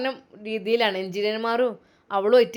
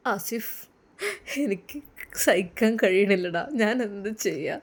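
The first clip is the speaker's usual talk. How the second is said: crying; 3 semitones lower, 3 dB quieter than usual. A narrator's reading. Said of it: "വല്ലാതെ കരഞ്ഞു അവൻ".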